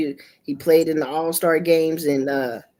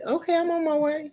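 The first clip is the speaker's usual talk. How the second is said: resigned mom; 12 semitones higher, 3 dB quieter, faster than usual. Retold or imitated. Mom agreeing to pick up.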